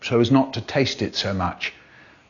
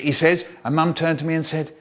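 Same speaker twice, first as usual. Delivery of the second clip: usual speaking voice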